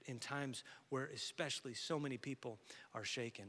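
quiet, gentle reassurance; soft pastoral encouragement. Acted. Pastor gently reassures his shaken congregation, soft and tender. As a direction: speak softly, comforting the shaken.

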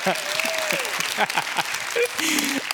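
real high burst of laughter. Real, not acted. Pastor laughing at his own joke, high laugh.

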